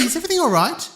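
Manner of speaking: normal talking voice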